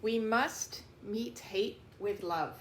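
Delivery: ordinary delivery, nothing marked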